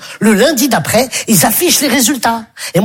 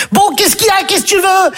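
much higher, lightly voiced annoyed girl; imitating Stéphanie; 8 semitones higher than usual. Stéphanie turning round on the boy following her. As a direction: snap back as the annoyed girl. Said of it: "elle a dit à mon pote".

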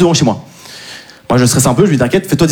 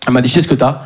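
ordinary talk

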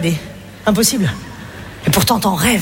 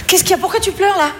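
higher, concerned coo; mock soothing mum; 8 semitones higher than usual. A mum fussing over her crying child, mock soothing.

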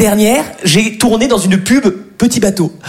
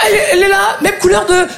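flustered; 10 semitones higher, faster than usual. Retold or imitated. Flustered explaining to police.

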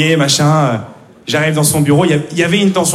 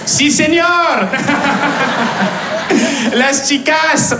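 high, hammed-up fake Spanish with laughter; mock Spanish; 7 semitones higher, much slower than usual. Intern bluffing the recruiter, hammed-up Spanish.

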